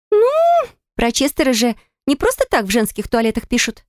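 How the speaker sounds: lightly voiced sly roommate; gossiping girl. A narrator's reading. Roommate gossiping about Chester.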